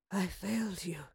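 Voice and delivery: faint, breathy near-whisper; weak. A narrator's reading. Dying queen confessing she failed, weakly. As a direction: barely get it out, very soft. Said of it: "she said weakly".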